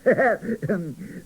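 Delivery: high jolly belly laugh; hearty laugh. Acted. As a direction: laugh at your own joke. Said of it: "folded up with laughter".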